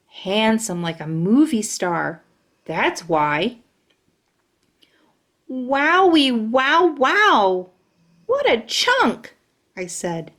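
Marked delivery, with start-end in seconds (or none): 5.5-9.3 high, slow dreamy wow; smitten awe. Acted. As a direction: swoon over him. Smitten at the handsome boy.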